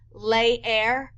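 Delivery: Leer with both E's sounded like "lay-air"; double E demo. Shown as a demonstration. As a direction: sound each E in Leer separately. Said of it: "you're gonna pronounce it both times".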